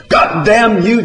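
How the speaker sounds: high, loud curse; furious scream. A narrator's reading. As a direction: scream it at the pig. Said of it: "John screamed".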